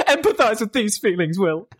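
words pushed through real laughter, high; high-pitched laughing jibe; laughing taunt. Real, not acted. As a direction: crack up while teasing a friend. Laughing taunt amid big laughter.

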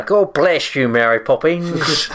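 high, loud mock Cockney, drawn-out slow on Mary Poppins. Mimicking the Mary Poppins Cockney accent for a friend, hammed-up Cockney.

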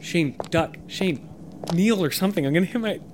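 high, urgent warning to a partner; mock panic. Rider on Shane's shoulders about to hit head, mock panic. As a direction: plead in a high, panicky pitch.

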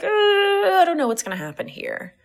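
long high wail falling into worry; hammed-up uncertain "uhhh", loud and high; mock panic. Business owner facing too many experiments, in mock panic.

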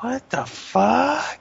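drawn-out, high-pitched mock disbelief; exaggerated stunned reaction; incredulous. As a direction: stretch it out slowly, rising way up in bafflement. Acting out his stunned reaction.

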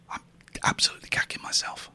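hushed nervous whisper. Real, not acted. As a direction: whisper so the line can't hear. Waiting nervously on call.

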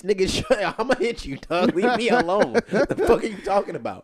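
loud, high, laughing comeback; mock angry retort. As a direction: snap back at the preachy friend, half laughing.

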